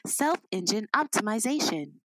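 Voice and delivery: measured robot lady. Shown as a demonstration. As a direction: speak like a computer assistant. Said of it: "This is my robot lady voice".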